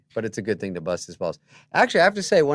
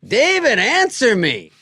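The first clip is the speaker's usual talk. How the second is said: much higher and louder, calling out; mock demanding call; 12 semitones higher, 13 dB louder, much slower than usual. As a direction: shout the name like a needy friend. Norm's late-night call voice.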